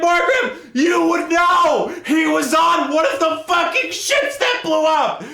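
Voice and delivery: high, loud, slowed yell at the character; exasperated shout. Retold or imitated. Reader yelling at Morgrim in the book, an exasperated shout. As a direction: scold Morgrim in disbelief. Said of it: "I'm like".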